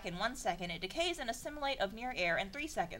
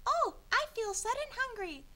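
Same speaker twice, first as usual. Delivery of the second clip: put-on much higher girly princess voice; 13 semitones higher than usual. Princess Peach suddenly hungry, high girly voice. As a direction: squeak it out like a dainty princess. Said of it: "The sound is made by Princess".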